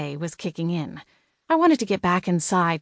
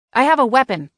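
raised, louder warning; called-out threat; 7 semitones higher, 6 dB louder, faster than usual. A narrator's reading. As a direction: warn an intruder loudly, pitched up.

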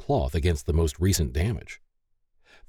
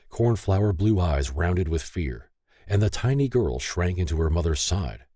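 ordinary delivery

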